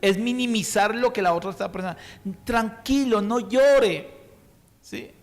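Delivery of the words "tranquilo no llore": raised, louder brushing-off voice; mock the careless comforter telling them to stop crying; imitating a pushy consoler who shuts down tears; dismissive consoler. Mocking insensitive consolers.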